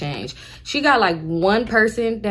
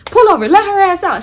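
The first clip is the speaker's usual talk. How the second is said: shouted order; 11 semitones higher, 9 dB louder than usual. Retold or imitated. Nephew yells at driver.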